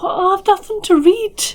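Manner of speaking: mock dismay. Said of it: "then it'll be like".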